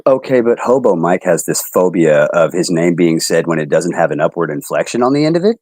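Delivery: ordinary delivery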